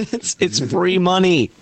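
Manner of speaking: high, slow mock farmer punchline; lightly imitated farmer, high and drawn out; comedy-sketch farmer. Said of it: "It's like".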